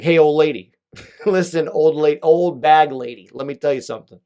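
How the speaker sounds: loud, cocky hammed-up hail; mock call-out. Retelling a loud cheeky hail to the old lady. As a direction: yell it like a brash heckler.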